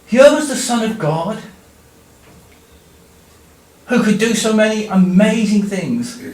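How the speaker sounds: ordinary talk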